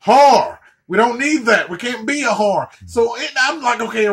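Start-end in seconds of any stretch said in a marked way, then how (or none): 0.0-0.6 loud correction. Acted.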